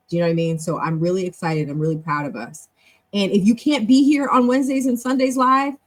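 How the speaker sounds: normal talking voice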